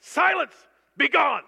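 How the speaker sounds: very loud, high commanding burst; stern. Acted. Preacher voicing Jesus dismissing the demon, stern. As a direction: fire off two forceful orders. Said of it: "He said it sternly".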